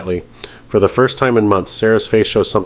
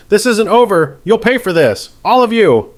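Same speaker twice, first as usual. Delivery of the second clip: screamed threat; 12 semitones higher than usual. Acted. Defendant dragged out, screaming a last threat. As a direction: scream it over your shoulder.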